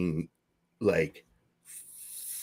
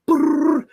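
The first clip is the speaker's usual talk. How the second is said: mock engine rev; 8 dB louder than usual. Sound of taking off running.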